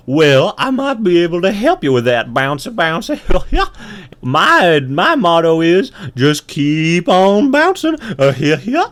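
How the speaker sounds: high, loud kangaroo voice; bouncy kangaroo. Acted. Kangaroo sharing his motto with the ant, loud bouncy voice. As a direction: boom it out with a bouncing swing. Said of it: "And the kangaroo says".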